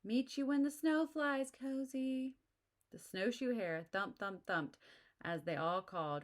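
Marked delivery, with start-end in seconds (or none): 0.0-2.4 high, squeaky calls of little animals; little animals calling out goodbye to Cozy; squeaky farewell voices. A narrator's reading. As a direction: call goodbye like tiny creatures. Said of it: "squeaky and growly and whistling voices".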